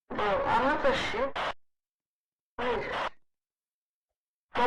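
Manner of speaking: normal talking voice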